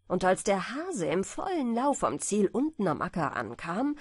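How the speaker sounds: ordinary delivery, nothing marked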